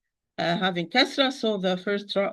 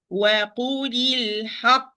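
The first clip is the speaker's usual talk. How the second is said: "وَقُولِ الْحَقِّ" stopping with a push into the final ق; nabr on final qaf; 3 semitones higher, much faster than usual. Shown as a demonstration. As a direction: stress the ending of "الْحَقِّ" and stop on ق. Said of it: "I have to apply nabr".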